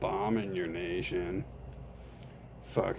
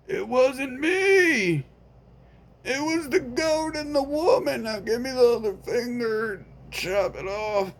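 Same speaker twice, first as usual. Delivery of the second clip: a higher, offhand friend voice; lightly voiced friend; 5 dB louder than usual. Friend refusing to help, mildly raised voice. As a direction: shrug it off, unbothered.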